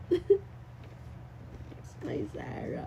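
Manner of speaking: very slow breathy whisper; tender, overcome, barely voiced. Real, not acted. Whisper after reading letter. (pabulong na may lambing)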